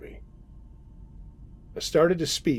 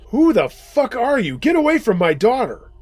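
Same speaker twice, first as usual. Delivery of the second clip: high, loud protective yell; raised, high-pitched angry demand; father's scream; 10 semitones higher, 6 dB louder than usual. A narrator's reading. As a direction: shout down an intruder threatening your child. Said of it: "until he screamed".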